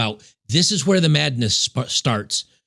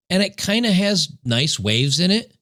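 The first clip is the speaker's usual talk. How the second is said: usual speaking voice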